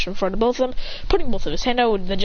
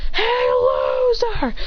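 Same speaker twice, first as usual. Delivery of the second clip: high, drawn-out jeering call; Sasuke; 14 semitones higher, much slower than usual. Acted. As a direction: climb higher through a mocking holler. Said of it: "says it for the third time".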